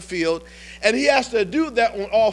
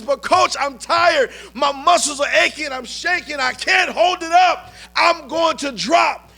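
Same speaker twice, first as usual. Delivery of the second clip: desperate shout; 6 semitones higher than usual. Retold or imitated. A worn-out player shouting to his coach that he's shaking. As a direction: cry out that your body is giving up. Brock pleads he is failing.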